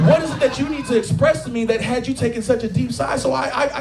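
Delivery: ordinary talk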